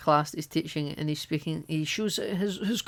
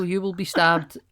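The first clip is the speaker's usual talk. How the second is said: ordinary talk, nothing marked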